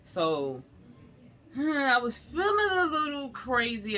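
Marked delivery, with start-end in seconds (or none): 1.5-4.0 fighting back tears; a real cry breaking through; tearful. Real, not acted. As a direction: voice catching on a cry, pitched up. Recalling the worrying moment.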